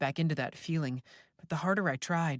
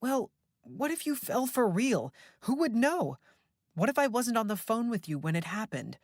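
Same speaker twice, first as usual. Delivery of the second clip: worried daughter; 8 semitones higher than usual. A narrator's reading. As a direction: press him, anxious and insistent. Beth worried, arguing.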